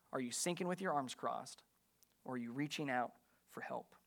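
soft, low closing question; hushed appeal. Real, not acted. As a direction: let it fade nearly to a whisper. Preacher's closing appeal to congregation.

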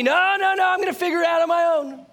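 loud, high, fast defiant no; stubborn refusal. Acted. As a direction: refuse loudly, wave it off. Person refusing God.

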